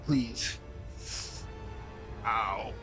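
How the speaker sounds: usual speaking voice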